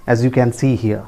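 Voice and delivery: ordinary delivery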